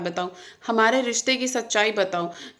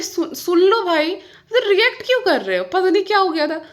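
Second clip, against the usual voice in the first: higher-pitched, mildly raised retold protest; exasperated retort; 8 semitones higher, 3 dB louder than usual. Wife to husband busy on phone, exasperated retort. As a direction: re-enact an exasperated plea to be heard.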